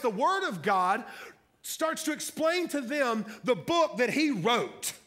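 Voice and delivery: high, rhythmic pulpit build-up on repeated phrases; fired-up preaching. Real, not acted. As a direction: pile up the irony with mounting preacher's fervour. Preaches excitedly on the irony.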